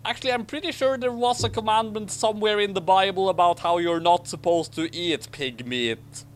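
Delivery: ordinary talk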